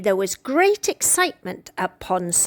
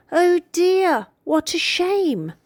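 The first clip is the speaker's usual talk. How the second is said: disappointed; 8 semitones higher than usual. A narrator's reading. Sad phone news.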